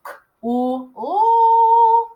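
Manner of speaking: "K, ooh, ooh" with the last held high; short K, then long sung-out "ooh"; sounding out. Shown as a demonstration. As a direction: stretch the sounds apart, rising high. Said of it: "I hear k".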